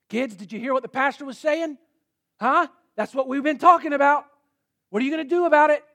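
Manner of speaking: hammed-up parent lecturing in the car; a churchgoing mom scolding her kids, mock loud; scolding mother. Mother lecturing kids driving home.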